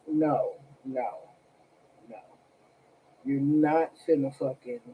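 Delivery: ordinary delivery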